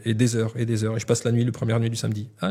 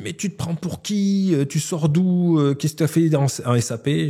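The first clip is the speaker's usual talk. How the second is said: slow, much higher mock yell at the newcomer; retold angry yelling; 6 semitones higher than usual. Senior developer berating the newcomer in the meeting, mock angry yell. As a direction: replay the expert's outburst, drawn out and pitched up. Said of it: "qu'il se met à me hurler dessus".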